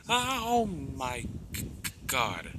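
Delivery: slow, stammering horrified gasp; stumbling shock. Acted. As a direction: back away in shock. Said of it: "stumbles back saying".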